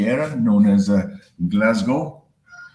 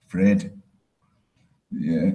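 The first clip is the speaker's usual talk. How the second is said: ordinary delivery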